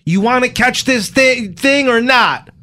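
high, drawn-out acted challenge; mock exasperation. Lawman pushing helper to read, mock exasperated. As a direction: press them like a fed-up movie lawman.

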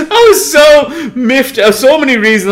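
half-crying with laughter; laughing. Real, not acted. To his co-host, howling with laughter about being miffed. Laughing while retelling annoyance.

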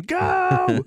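very high, drawn-out cheering yell; re-enacted excited yelling. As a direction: yell it like urging a racer on from home. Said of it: "jumping out of my seat and go, yelling".

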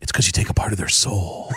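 a hint of Baldwin, half laughing; Alec Baldwin impression. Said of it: "And Baldwin said".

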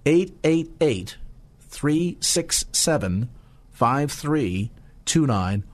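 slow, spaced-out phone number; deliberately slow. Shown as a demonstration. Radio host answers being told he talks too fast, deliberately slow. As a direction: read it out very slowly, pausing between groups. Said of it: "[name], you talk too fast".

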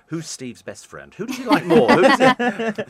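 mock squabbling fans, jumping high and loud, breaking into a laugh; competitive fans. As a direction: imitate jealous fans vying, hammed-up. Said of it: "they're very competitive with each other".

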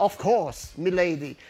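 affected British English, dropping low; hammed-up British English; mock British accent. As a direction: put on a pompous British accent, lightly. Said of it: "com sotaque britânico".

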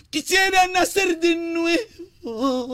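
slow, crying sung line; a mock weeping plea, drawn out; sobbing lament. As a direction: wail it out like a sad huayno.

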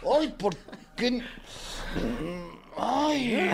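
high mock lament; dad sighing over his teenagers, high and hammed-up; exasperated dad. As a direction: wail it high like a fed-up father. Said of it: "Tú dices".

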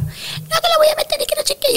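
high mocking imitation of the mother. Niurka mocking the mother who refused. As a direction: squeak it out like the other woman.

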